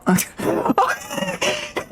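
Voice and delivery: high squealing laughing fit; shrieking laugh. Real, not acted. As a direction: burst out in a high cracking laugh. Cracks up at own voice.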